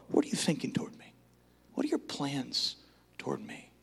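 quick whisper fading away; whispered prayer. Shown as a demonstration. Preacher showing how to ask God, whispered. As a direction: whisper it to God, almost gone. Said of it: "If you ask God".

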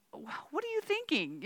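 mock-exasperated, high-pitched question; retold inner exasperation. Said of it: "you know, inside".

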